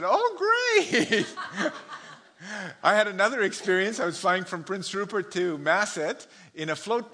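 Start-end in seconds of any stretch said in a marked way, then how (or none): 0.0-1.7 laughing, dry "oh great"; sarcastic laugh. Retold or imitated. Nervous passenger to the audience, laughing sarcastically. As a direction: toss it off with a high laugh. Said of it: "And I said".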